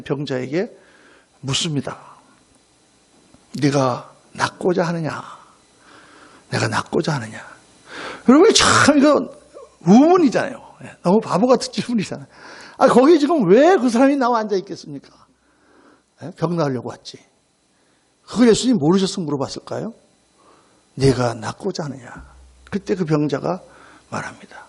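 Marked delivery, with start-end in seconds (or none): 3.6-7.4 low, slow, measured question; solemn Jesus. Retold or imitated. Jesus asks the man ill thirty-eight years, solemn and low. 20.9-22.2 low, slow, measured question; solemn Jesus. Retold or imitated. Jesus asks the man ill thirty-eight years, solemn and low.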